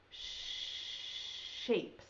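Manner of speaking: "shapes" breathed out, stretching the sh; whispered sh sound. Shown as a demonstration. Teacher whispering the word so kids hear the first sound. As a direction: hiss the sh of "shapes" softly. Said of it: "Does it start with S H".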